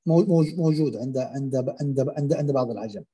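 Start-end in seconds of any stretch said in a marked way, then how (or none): none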